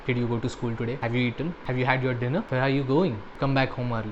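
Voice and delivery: flat robotic family. Retold or imitated. Reviewer mimicking the film's family asking routine questions, flat and robotic. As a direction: read the questions like a cold, mannered robot. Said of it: "They talk like".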